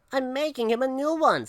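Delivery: put-on high little-boy voice; lightly whiny boy; protesting child. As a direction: pipe up with a kid's excuse. Boy makes excuse.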